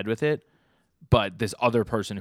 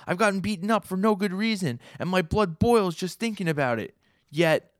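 high, strained, earnest voice; voicing the pig guy; 10 semitones higher, 5 dB louder than usual. Pig guy answering the spider leader, high strained earnest voice. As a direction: torn big guy wrestling with his anger. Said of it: "the pig guy's like".

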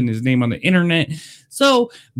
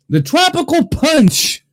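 much higher, slow, drawn-out product reveal; announcer reveal; 12 semitones higher, slower than usual. Real, not acted. Vlogger unveiling Tropical Punch for his viewers, slow high announcer voice. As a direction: present it like a game show prize. Announcing next flavor like a host.

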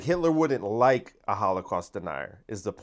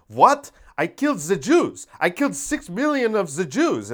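much higher and louder indignant outburst; outraged Hitler; 8 semitones higher, 4 dB louder than usual. Retold or imitated. Mocking an indignant Hitler, hammed-up high yell. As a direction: play an offended dictator, hammed-up. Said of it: "He'd be like".

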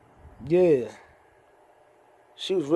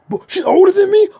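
high, loud surprised question; startled and delighted; 12 dB louder than usual. Real, not acted. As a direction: jump up in pitch, taken aback. Reads her age online.

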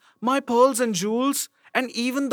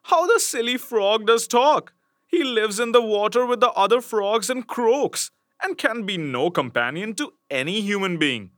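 scornful princess; 7 semitones higher than usual. Acted. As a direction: sneer at the silly frog. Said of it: "She, however, thought".

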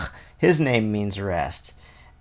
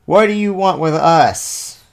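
loud, slow demon's cry; cried out; 5 semitones higher, 6 dB louder, slower than usual. A narrator's reading. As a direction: call out the challenge slowly and loudly. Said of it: "cried out".

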